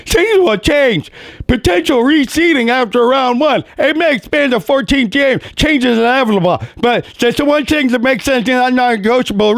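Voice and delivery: high, slobbery Daffy Duck-like voice; mock Cowherd with a sputtering cartoon-duck voice; a bad, hammed-up Cowherd impression; Colin Cowherd impression. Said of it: "I'm gonna talk like him. He talks like Daffy Duck".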